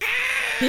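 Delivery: very high, squealing yelp; droid squeal. Retold or imitated. Mouse droid fleeing Chewbacca, high squeal. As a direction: squeal in panic. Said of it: "And he's like".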